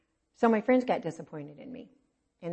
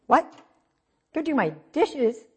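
high, louder disbelieving repeat; incredulous echo; 6 semitones higher, 5 dB louder than usual. Retold or imitated. Woman echoing God's odd command, incredulous and louder. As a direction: throw the words back in disbelief.

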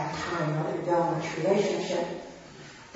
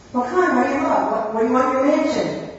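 higher, fast, pushing him for an answer; eager coaxing; 7 semitones higher, 4 dB louder, faster than usual. Retold or imitated. Wife coaxing her husband, eager and fast. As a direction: coax him eagerly, quick and high.

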